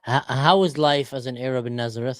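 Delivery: usual speaking voice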